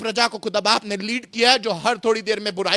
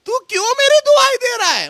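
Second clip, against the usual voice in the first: high, raised-voice challenge; dramatic rebuke; 14 semitones higher, 4 dB louder than usual. Acted. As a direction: demand an answer, pitched way up. God rebukes Moses, read dramatically. (ऊँची आवाज़ में नाटकीय फटकार)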